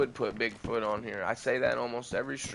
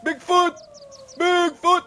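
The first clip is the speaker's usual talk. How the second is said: high, loud howling call into the woods; Yeti call; 8 dB louder, slower than usual. Shown as a demonstration. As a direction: bellow it out like a wild beast call. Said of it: "I'm gonna do my Yeti call".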